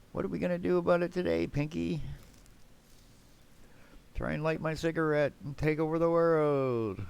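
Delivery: high, fast cartoon sidekick voice. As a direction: squeak it out like a cartoon mouse. Pinky and the Brain quote.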